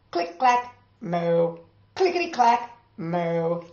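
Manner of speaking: ordinary delivery, nothing marked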